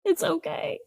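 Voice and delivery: high, soft, soothing little voice; mock soothing. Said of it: "I was like".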